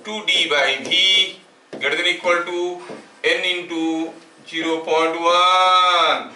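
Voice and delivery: word by word, drawn out, with long pauses; slow dictation. Real, not acted. Teacher giving students notes to write, slow dictation. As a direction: dictate slowly with long stretched syllables.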